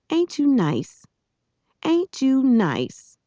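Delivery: put-on high-pitched scolding girl; Amanda Beale. A narrator's reading. Girl rousing a sleeping boy by the ear, high and scolding. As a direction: wake him with a high, drawn-out jab. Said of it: "the voice of Amanda Beale".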